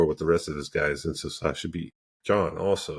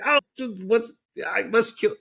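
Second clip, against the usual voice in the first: high, hammed-up battle-crazed self; a retold gamer getting tunnel vision, in a high mock-crazed voice; mock frenzied. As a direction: squeal it like a hyped-up warrior bent on killing. Retold himself fixated on killing.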